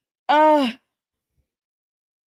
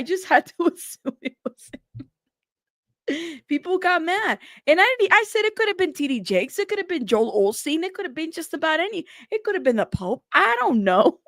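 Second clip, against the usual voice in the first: laughing; 7 semitones higher than usual. Real, not acted. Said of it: "Sorry, I'm laughing".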